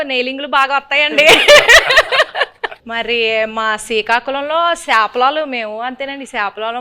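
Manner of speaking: host in a put-on Srikakulam village accent; playful Srikakulam dialect, lightly done; Srikakulam slang. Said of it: "కొంచెం Srikakulam slang బానే ఉందండి".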